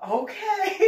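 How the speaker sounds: high breathy laughing "okay". Real, not acted. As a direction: dissolve into laughter. Laughing while answering.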